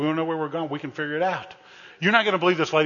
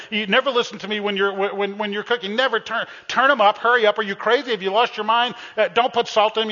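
mock nagging; 7 semitones higher, 4 dB louder than usual. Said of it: "I said".

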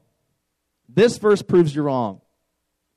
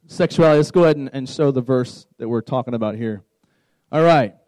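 normal talking voice

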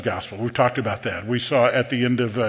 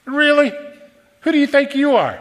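much higher, louder mocking scoff; scoffing disbelief; 12 semitones higher, 5 dB louder than usual. Crowd scoffing at Jesus in temple, mocking disbelief. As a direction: laugh him off with scorn.

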